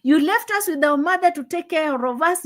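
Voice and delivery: high, scornful impression of the daughter; a daughter jeering at her dying father, high and scornful; mocking daughter voice. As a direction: sneer it out high and cutting. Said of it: "He said".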